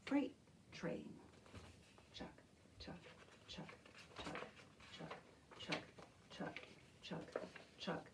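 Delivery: soft whispered chuck beat; quiet train chant; whispered train rhythm. Shown as a demonstration. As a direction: whisper the chugs steadily. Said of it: "Keep that beat going".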